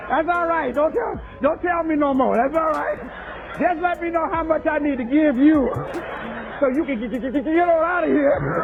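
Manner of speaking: flustered customer. Retold or imitated. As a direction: rush her off through laughter. Flustered customers hurrying her off.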